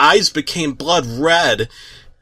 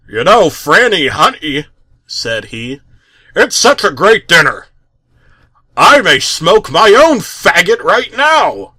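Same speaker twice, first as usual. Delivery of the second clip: lightly voiced Stan; 2 semitones higher, 5 dB louder than usual. A narrator's reading.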